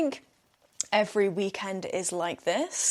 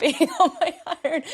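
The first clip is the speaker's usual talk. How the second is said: ordinary delivery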